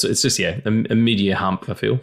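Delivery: ordinary talk, nothing marked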